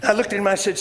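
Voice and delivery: usual speaking voice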